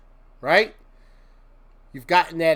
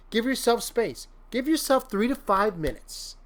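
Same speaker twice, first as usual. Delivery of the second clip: ordinary delivery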